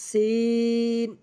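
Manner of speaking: সিন with long hissed s, long vowel; airy drawn-out sin. Shown as a demonstration. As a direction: let air out lightly, then stretch সিন. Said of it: "আগে হালকা করে বাতাস বের করে". (হালকা বাতাস ছেড়ে টেনে সিন)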